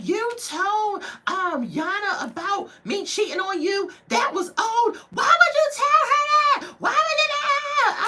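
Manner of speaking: high, loud shrieking accusations; screaming accusation. Retold or imitated. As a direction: shriek it at him, furious. Girlfriend screaming at boyfriend.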